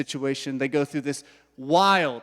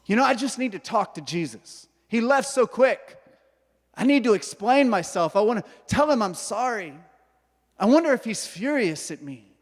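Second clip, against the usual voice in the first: higher, fretful inner voice, questions tumbling out; a hint of guilty, pleading worry; anxious Peter; 6 semitones higher than usual. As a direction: play Peter worried sick and waiting. Peter anxiously waiting for Jesus.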